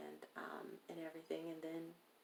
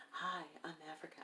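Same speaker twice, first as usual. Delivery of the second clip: sexy mode; 6 dB quieter, faster than usual. Retold or imitated. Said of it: "trying to be in sexy mode".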